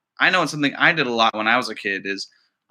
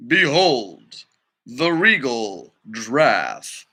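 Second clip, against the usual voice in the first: slow, lower, majestic presentation; grand narrator; 3 semitones lower, much slower than usual. Acted. Teacher reading the narrator presenting the giraffe, grand. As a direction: unveil the giraffe with ceremony.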